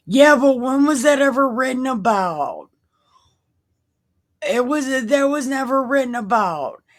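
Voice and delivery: loud, high-pitched disbelief; incredulous objection. Real, not acted. Reader questioning an unfamiliar Bible passage, incredulous. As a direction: challenge it, pitched well up. Baffled by the passage she reads.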